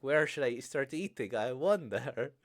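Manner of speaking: loud, hammed-up reading of the line; loud, gleeful re-read of the quote; loud mock villain. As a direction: belt it out as a playful villain imitation.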